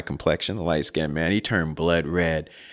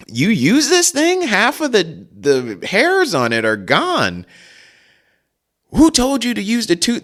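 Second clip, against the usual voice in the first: much higher, louder incredulous retort; kids talking back; 13 semitones higher, 4 dB louder than usual. Retold or imitated. Boys mock dad's old toothbrush, high incredulous sass. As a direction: sass him like cheeky kids. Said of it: "And we're like".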